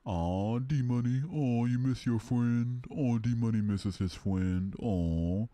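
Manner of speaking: put-on high, loud babying coo; slow mocking baby talk; mock pity. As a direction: coo at him like a sad baby. Mocking a chatter's sad friend.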